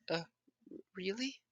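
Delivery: small high question; high kid voice. Retold or imitated. Kid asking Naruto, high and soft. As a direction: ask it like a hopeful boy.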